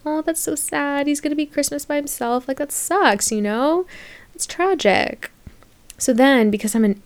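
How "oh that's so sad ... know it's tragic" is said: higher, softer, fast sympathy; mock pity. Imagining feeling sorry for him, high soft mock pity. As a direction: coo over him with exaggerated pity. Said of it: "And I'm like".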